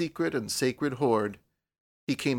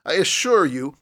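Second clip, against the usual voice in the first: loud, higher-pitched indignant protest; 5 semitones higher, 7 dB louder than usual. A narrator's reading. Young man denying designs on the girl, indignant. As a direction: protest hotly, each point driven home loudly. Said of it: "he cried indignantly".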